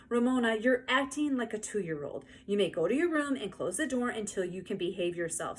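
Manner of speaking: ordinary delivery, nothing marked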